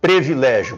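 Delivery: privilégio as "pre-vi-LÉ-gio"; wrong form of privilégio, E in first syllable. Shown as a demonstration. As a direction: open privilégio on "pre" instead of "pri". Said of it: "Privilégio não existe".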